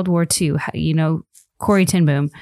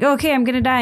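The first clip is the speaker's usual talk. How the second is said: mock-casual, pitched well up; breezy, higher-voiced acted quote; casual acceptance; 8 semitones higher, faster than usual. Person on deathbed addressing God, mock-casual.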